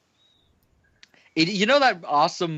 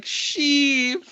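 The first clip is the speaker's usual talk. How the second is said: mock tearful cry; 11 semitones higher than usual. Vader tearfully hugs Palpatine, acted.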